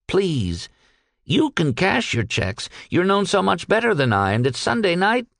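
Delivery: high-pitched earnest plea; pleading. Acted. Peck begging the captain to cash checks, pleading. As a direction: coax with rising urgency.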